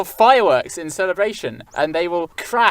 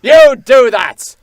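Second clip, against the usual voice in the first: scornful sneer; 5 semitones higher, 11 dB louder, much slower than usual. Acted. Scornful husband.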